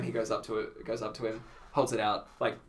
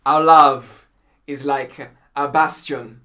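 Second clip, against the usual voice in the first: slow, louder mock-solemn love line; grand romantic recital; 11 dB louder, much slower than usual. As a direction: deliver it like a theatrical suitor. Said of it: "he was like".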